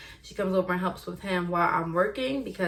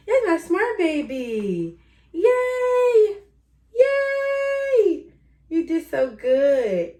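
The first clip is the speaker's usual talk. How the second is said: excited praise; 10 semitones higher, 5 dB louder, much slower than usual. Real, not acted. Mom praising baby.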